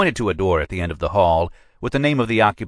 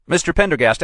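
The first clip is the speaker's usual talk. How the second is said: much higher and louder exasperated address; 11 semitones higher, 4 dB louder, faster than usual. A narrator's reading. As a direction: raise the voice on Mister Pendergast in impatience. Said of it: "as I said".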